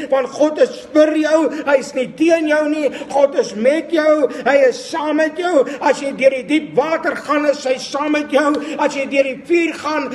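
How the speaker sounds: rising preacher's declarations, firm and repeated; preaching. Acted. Preacher declares God's promises over the congregation, fervent. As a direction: proclaim each promise with conviction.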